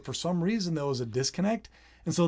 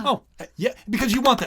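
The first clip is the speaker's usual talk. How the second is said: higher, louder, delighted agreement; light up with an eager yes; 8 semitones higher, 7 dB louder, faster than usual. Retold or imitated. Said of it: "You're like".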